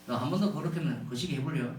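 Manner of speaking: low-key Jeolla dialect threat; a mild Jeolla father warning; understated Jeolla-dialect scolding; Jeolla dialect dad. Retold or imitated. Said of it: "전라도는 좀 분위기가 좀 약간 삭막해".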